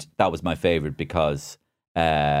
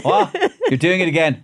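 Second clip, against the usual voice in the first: high, loud yelp of surprise; mock startled; 11 semitones higher, 5 dB louder than usual. Retelling being caught singing in the shower, high startled outburst. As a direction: leap up in pitch as if caught out. Retold interruption at shower door.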